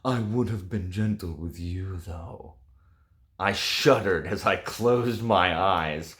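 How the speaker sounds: a hint of sultry audiobook narration; dramatic reading aloud. Reviewer reading a steamy scene to viewers, lightly dramatic. Reads book passage aloud.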